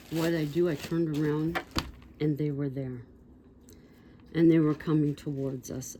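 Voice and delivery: ordinary delivery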